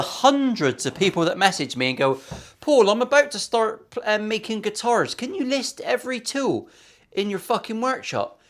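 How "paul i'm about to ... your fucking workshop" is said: high mocking voice of a clueless fan; sarky mock viewer. Mocking viewers who message him, in a high sarky voice. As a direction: take the mick out of a needy beginner, pitched up. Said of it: "here's a sarky one for you".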